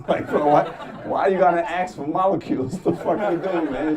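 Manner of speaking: loud, laughing bro outrage; half-laughing mock outrage; laughing disbelief. Real, not acted. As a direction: laugh through the question. Laughing at firemen with axe.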